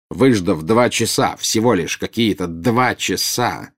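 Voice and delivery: usual speaking voice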